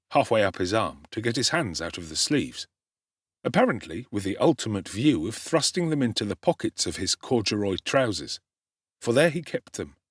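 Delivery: plain reading voice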